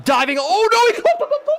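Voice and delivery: high squealing laugh through the words; real high-pitched laughing cry; excited laughing yelp. Real, not acted. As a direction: shriek it out, laughing in disbelief. Touchdown fumble at goal line.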